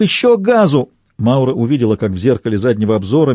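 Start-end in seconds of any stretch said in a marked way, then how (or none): 0.0-0.9 high, urgent narrated shout; urgent yell, pitched well up. A narrator's reading. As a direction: cry out to keep them going. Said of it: "кричал Дуг".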